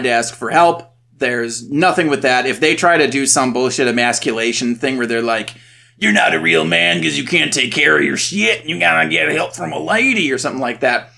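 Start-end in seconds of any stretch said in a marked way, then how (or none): 6.0-10.3 mock macho taunt in a Southern accent. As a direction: imitate a Southern man sneering. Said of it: "I did a Southern accent".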